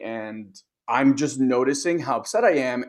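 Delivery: usual speaking voice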